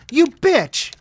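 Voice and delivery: high, loud shriek of rage; screaming. A narrator's reading. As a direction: scream it as she melts. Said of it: "screamed Glinda".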